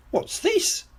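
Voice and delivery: put-on very high questioning child voice; curious Peppa. A narrator's reading. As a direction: ask it in a high squeak. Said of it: "says Peppa".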